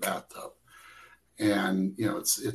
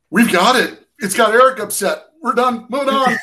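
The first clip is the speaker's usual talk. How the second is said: high, loud announcement to the whole crew; shouted wrap call; 13 semitones higher, 12 dB louder than usual. Retold or imitated. Marcos calls to the crew that they're moving on, shouted. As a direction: shout it out over the set.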